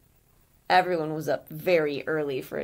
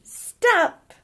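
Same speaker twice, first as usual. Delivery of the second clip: much higher, much louder shout; shouted stop; 13 semitones higher, 11 dB louder than usual. Acted. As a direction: stop them in their tracks. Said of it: "put her hands out and shouted".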